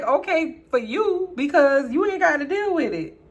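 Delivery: loud, high, indignant comeback; annoyed retort. Retold or imitated. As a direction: snap back in exasperation. Retold annoyed retort to the girl.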